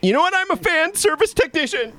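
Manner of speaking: squeaky self-announcement; high proud voice. Acted.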